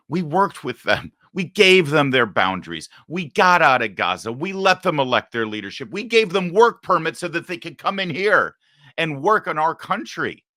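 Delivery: high, loud, fed-up cry of disbelief; impassioned outburst. Real, not acted. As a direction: let the frustration burst out at full voice. Angry rant on Gaza peace efforts.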